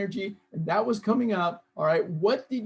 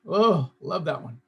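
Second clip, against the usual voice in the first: normal talking voice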